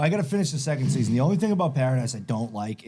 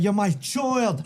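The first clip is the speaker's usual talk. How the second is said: high, slow Irish taunt; high put-on Irish accent; Conor McGregor; 7 semitones higher, much slower than usual. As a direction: stretch it out in a mock Irish brogue. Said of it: "that's what he says".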